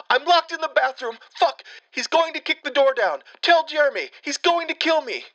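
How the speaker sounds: very high, frantic cries for help; panicked pleading. Retold or imitated. Scared girl begging stranger on the phone, terrified plea. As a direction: beg for your life, terrified. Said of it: "It is a sobbing, scared girl".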